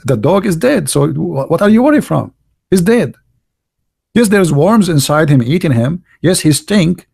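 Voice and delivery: normal talking voice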